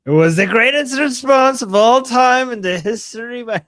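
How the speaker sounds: loud, high, slow Trump bluster. Pretend. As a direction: boast it like Trump at a rally. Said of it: "I didn't know I could do a Trump voice".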